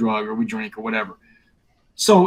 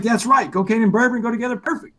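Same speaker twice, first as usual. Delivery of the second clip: light imitation of a Boston accent, higher; a hint of the sponsor's Boston accent, higher; 8 semitones higher than usual. Retelling his sponsor agreeing, in a lightly imitated Boston accent. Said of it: "He's got this Boston accent".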